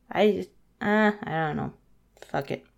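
usual speaking voice

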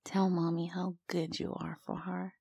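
normal talking voice

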